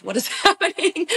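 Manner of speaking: high-pitched bewildered squeak with a laugh; mock alarm. Wife imagining husband hearing her bawl on the phone, mock alarm.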